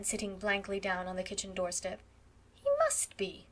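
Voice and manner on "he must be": much higher, disbelieving; sharp, high-pitched disbelief; incredulous. Acted. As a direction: refuse to believe it. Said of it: "said Phil incredulously".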